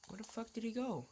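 ordinary talk

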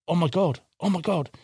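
a hint of growing horror; mild dismay. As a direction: realise something awful, half-voiced. Friend realises trailer stolen.

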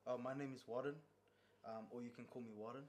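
normal talking voice

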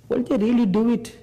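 a high, doubtful question from Moses; doubting Moses. Retold or imitated. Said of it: "he said".

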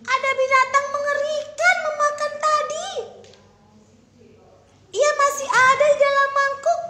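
very high, loud panicked shout; frightened shouting. Acted. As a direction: yell to the whole palace in fright. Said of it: "Lihat putri kecil teriak".

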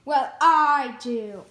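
loud, very slow villain boast; evil conniving voice. Acted. Rival claims the better evil voice, loud and slow. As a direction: gloat it out like a cartoon schemer. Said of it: "the better evil, conniving voice".

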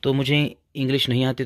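usual speaking voice

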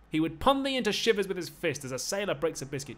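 high, loud taunting; loud threat. Acted. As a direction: goad him at full voice. Slave threatens master in play.

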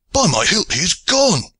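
high, sudden cry of surprise; startled cry. A narrator's reading. Aylward at the rail, crying out in surprise. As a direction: cry out in alarm. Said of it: "cried Aylward".

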